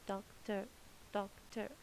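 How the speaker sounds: "Doctor" in two separate beats, doc-ter; split into syllables. Shown as a demonstration. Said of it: "How many vowel sounds I can hear?".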